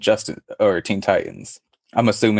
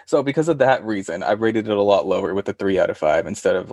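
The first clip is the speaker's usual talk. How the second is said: normal talking voice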